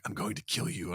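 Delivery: low, hushed superhero threat; Batman. Acted. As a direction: quietly menace him like a masked vigilante. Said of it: "grab the Joker and be like".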